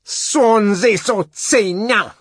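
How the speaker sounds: high, loud, slow ferocious curse; derisive ferocity. A narrator's reading. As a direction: hurl it with fierce derision. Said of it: "with what derisive ferocity".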